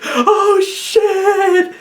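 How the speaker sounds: very high, put-on yelp of alarm; panicked knight. Knight seeing Asgore give away the code, high panic. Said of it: "the knight's gonna be like".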